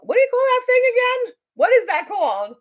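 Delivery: high, loud call across the room. Real, not acted. Speaker asks someone nearby for a forgotten word, calling out. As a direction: holler the question to someone farther off.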